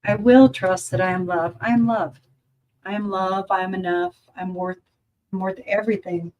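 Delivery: measured, earnest intention-setting; mirror affirmation. Shown as a demonstration. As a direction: declare it to yourself, weighing each phrase. Speaks affirmations to self in mirror.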